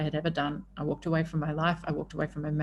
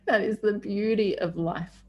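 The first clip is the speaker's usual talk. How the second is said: ordinary talk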